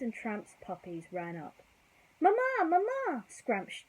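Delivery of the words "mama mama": high, loud excited puppy call; puppy shouting. Acted. Puppy Scamp runs up calling for his mother, high loud shout. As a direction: yell for mum like an eager pup. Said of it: "Scamp shouted".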